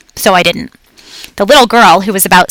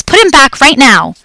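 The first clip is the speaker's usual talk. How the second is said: higher, louder firm dad order; stern command; 6 semitones higher, 3 dB louder than usual. A narrator's reading. Father scolding his daughter.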